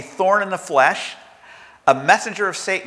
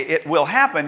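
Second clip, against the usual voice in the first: normal talking voice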